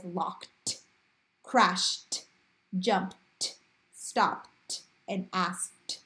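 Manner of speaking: "locked, crashed, jumped, stopped, asked" each ending in a separated "t"; t-sound endings. Shown as a demonstration. Teacher giving examples of unvoiced rule, endings split off as "t". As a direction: release a distinct voiceless "t" after each word. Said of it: "They're making that t sound".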